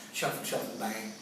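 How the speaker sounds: plain reading voice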